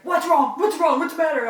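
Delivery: high, loud, anxious questioning; imitating worried dad. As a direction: fire off alarmed questions as a fretting father. Voicing worried dad's questions.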